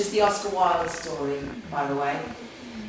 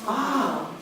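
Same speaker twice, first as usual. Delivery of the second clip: ordinary talk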